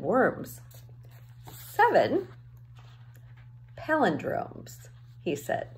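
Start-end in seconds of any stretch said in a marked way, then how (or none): 1.5-4.9 slow, drawn-out announcement of the task; a lightly voiced mentor setting a riddle. Old man sets next task.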